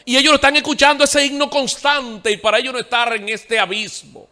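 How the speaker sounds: voz de predicador alta y aguda; prédica enérgica. Real, not acted. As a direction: proclama con fuerza, subiendo el tono. (predicación a plena voz)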